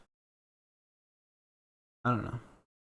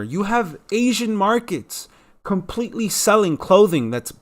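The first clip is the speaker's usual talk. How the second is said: worked-up, louder, higher-pitched rant; getting mad; 5 semitones higher, 5 dB louder than usual. Real, not acted. Vlogger ranting about America's disconnect, getting mad. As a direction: hammer the key words in rising exasperation. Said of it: "I don't know why I'm getting mad".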